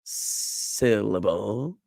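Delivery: slow "syllable", SIL-uh-BOOL with rising end; stress on first and last. Shown as a demonstration. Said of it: "on the first and last".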